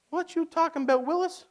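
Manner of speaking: high, joking Pharaoh retort; mock Pharaoh. Pharaoh snubbing Moses, high mock retort. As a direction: toss it off like a sitcom kid brushing him off. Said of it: "And Pharaoh's like".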